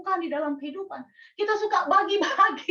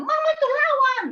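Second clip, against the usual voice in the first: raised, high-pitched plea to fight back; urging outcry; 10 semitones higher, 4 dB louder than usual. Retold or imitated. Children urge their bullied mama to fight back, high urging outcry. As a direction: cry out as an indignant child.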